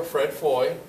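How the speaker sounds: usual speaking voice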